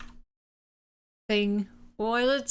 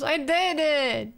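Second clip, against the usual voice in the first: triumphant; 6 semitones higher, slower than usual. Real, not acted. Triumphant after erasing the sleeves.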